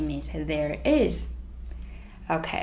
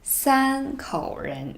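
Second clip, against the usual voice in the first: slow demo; 7 semitones higher, much slower than usual. Shown as a demonstration. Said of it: "let's try one more time".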